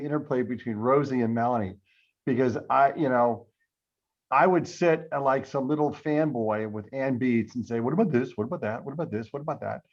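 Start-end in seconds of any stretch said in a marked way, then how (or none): none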